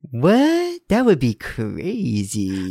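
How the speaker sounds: mock disbelief, stretch the last word long and slow; imitating Andrew. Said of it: "Andrew's is, is when he goes".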